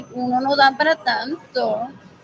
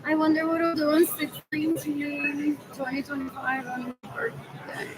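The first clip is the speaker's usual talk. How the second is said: ordinary delivery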